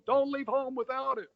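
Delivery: high-pitched mock heckle; audience heckler. Moviegoer filling in the punchline at the screen, high heckle. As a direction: call it out like a wisecracking moviegoer. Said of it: "some guy in the audience that would go".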